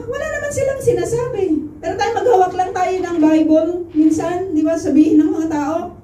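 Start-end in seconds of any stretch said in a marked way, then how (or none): 0.0-1.4 much higher and fast, rattling off "silang"; fast mocking repeat. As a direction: rush the mocking line out high. Repeats mocking line faster.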